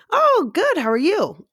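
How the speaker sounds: very high, loud imitated cheery answer; perky reply. Customer returning a barista's greeting, perky. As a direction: gush back at the greeting.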